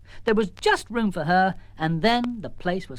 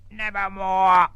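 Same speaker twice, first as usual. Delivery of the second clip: louder croaking raven; 2 semitones higher, 6 dB louder, much slower than usual. Acted. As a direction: croak it fondly, wrapping her close. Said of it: "wrapped his left hand wing round her and said".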